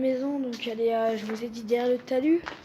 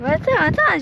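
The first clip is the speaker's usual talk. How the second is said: shouting over wind; 4 semitones higher, 7 dB louder than usual. Real, not acted. Calling out on windy site.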